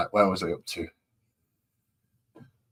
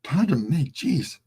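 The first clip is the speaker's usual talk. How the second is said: excusing a burp; 8 semitones higher, 4 dB louder, slower than usual. Real, not acted. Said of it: "If I burp a lot tonight".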